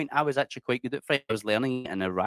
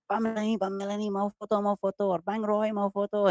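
much higher, eager fan voice calling a celebrity's name; excited fans calling out; 11 semitones higher than usual. Retold or imitated. Fans in a Bali shopping centre calling out for photos, high and eager. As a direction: play a starstruck fan begging for a photo. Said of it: "people are always like".

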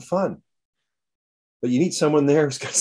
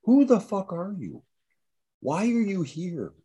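higher, baffled questions, a touch of mock alarm; bewildered onlooker; 8 semitones higher than usual. Imagined bystander questioning Gandalf in Bree, lightly voiced bafflement. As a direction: play a stranger half-heartedly grilling a wizard. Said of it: "come up to Gandalf and go".

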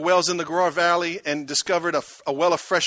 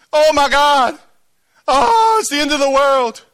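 mock despair; 9 semitones higher, 10 dB louder than usual. Mock despair about being pushed out.

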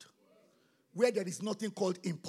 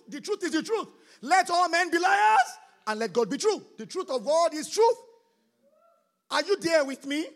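shouted, higher and louder; loud declaration; 7 semitones higher, 10 dB louder than usual. Real, not acted. Pastor declaring God's truth to the church, loud proclamation. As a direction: proclaim it like a battle cry. Preacher declaring at full force.

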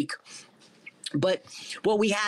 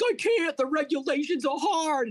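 high, mocking whiny voice, lightly put on; mock whiny rivals; 9 semitones higher than usual. Rivals complaining to him about regulations, high mocking whine. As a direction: imitate rivals whining in a high pitch. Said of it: "And they're like".

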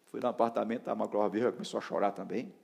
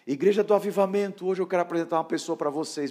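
higher and louder, proclaiming to the hall; announcing loudly; 8 semitones higher, 9 dB louder than usual. Retold or imitated. Pastor announcing a guest to the congregation, loudly. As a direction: present him like a herald.

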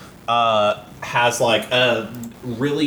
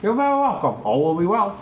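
put-on Cockney accent; 6 semitones higher, faster than usual. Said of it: "doing this Cockney accent".

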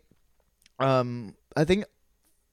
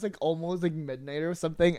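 ordinary talk, nothing marked

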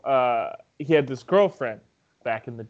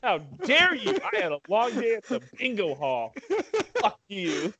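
lightly pitched-up mock rant; mock outraged Michael; 6 semitones higher than usual. Friend keeps mimicking Michael's rant at the bar guy, mock outrage. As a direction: play the offended bro, hammed. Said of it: "how he says it".

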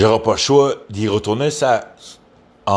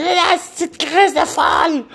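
high squeaky mimic of a nagging woman; mock her in a shrill falsetto; mocking woman's voice. Mocking a woman online in a high squeaky voice. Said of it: "Puis elle a dit".

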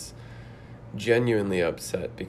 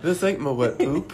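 put-on raised-pitch grumble in a silly Jamestown accent; mock Jamestown accent; 5 semitones higher, 4 dB louder than usual. Customer rejecting what he was served, in a mock Jamestown accent. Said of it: "Practicing my Jamestown accent".

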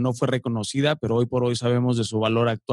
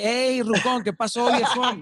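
street greeting; 9 semitones higher, slower than usual. Retold or imitated. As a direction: call out to a pal on the street. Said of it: "si vas a la calle".

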